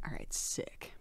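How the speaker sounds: soft pleased whisper. Real, not acted. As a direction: whisper it to yourself. Whispered aside.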